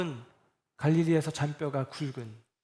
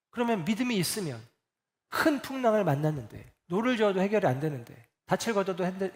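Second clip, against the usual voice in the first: usual speaking voice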